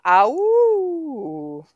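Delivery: high, loud howl; wolf howl. Shown as a demonstration. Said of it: "the next one on the list is the howl".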